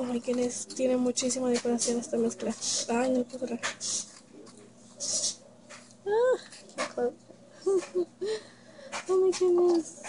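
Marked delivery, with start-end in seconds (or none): 6.1-10.0 high-pitched squeal of delight with a little laugh; real giddy delight, pitched way up; delighted gushing. Real, not acted. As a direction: gush in a high, thrilled voice. Delighted reaction to the mixes.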